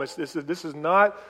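normal talking voice

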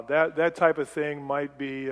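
ordinary talk

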